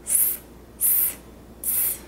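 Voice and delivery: whispered S hisses on their own; isolated S. Shown as a demonstration. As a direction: hiss short S, S, S. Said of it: "the S sound all by itself".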